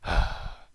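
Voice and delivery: breathy effortful sigh of exhaustion; exhausted sigh. Acted. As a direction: let out a worn-out sigh. Velvet moves painfully, exhausted.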